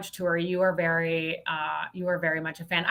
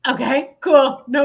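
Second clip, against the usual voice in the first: loud, flat dismissive aside; deadpan afterthought; 7 semitones higher, 9 dB louder, slower than usual. Retold or imitated. Saleswoman mocking herself after the call. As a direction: shrug it off after hanging up. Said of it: "afterwards you're like".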